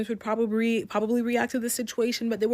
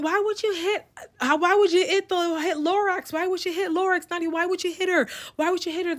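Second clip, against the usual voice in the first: much higher pitched, accusing mock yell; 10 semitones higher than usual. The friends accusing Nadia of hitting Lorax, high mock yell. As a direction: imitate the group crying out at her. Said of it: "Everybody starts yelling".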